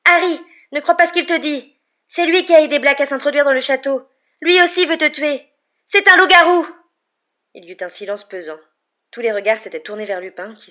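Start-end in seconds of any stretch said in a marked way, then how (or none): 0.1-6.7 high, loud, frantic warning building to a scream; exclaimed warning. A narrator's reading. Girl warning her friend about the werewolf, crying out. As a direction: shout the warning, peaking on the revelation. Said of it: "s'exclama Hermione".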